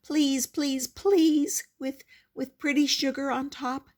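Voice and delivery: high, loud, mounting pleading; begging child. A narrator's reading. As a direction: beg harder and harder. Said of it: "I begged".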